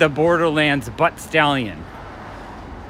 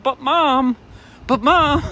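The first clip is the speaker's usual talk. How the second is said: high whiny "But Mom"; whining kid; 8 semitones higher, slower than usual. Retold or imitated. A toy shop owner imitating a six-year-old whining to keep looking, high whine. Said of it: "And they're like".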